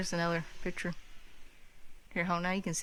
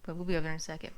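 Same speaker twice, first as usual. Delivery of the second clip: normal talking voice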